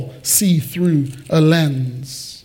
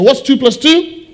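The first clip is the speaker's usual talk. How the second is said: higher-pitched call to the crowd; rallying call-out; 6 semitones higher, 3 dB louder than usual. Real, not acted. Preacher getting congregation to shout the answer, rallying call-out. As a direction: throw the question out for everyone to shout back.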